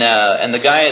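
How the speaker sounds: ordinary delivery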